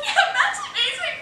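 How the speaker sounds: very high, strangled crying voice; mock sobbing joke. Maid of honor joking mid-speech about the groom, mock sobbing. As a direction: try a joke through imitated sobs. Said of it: "trying to make a joke".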